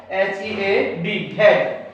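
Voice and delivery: letters H E A D spelled out slowly; slow spelling. Shown as a demonstration. Teacher slowly spelling a word for students. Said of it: "थोड़ा सा वो मिसकन्फ्यूजन हो गई थी".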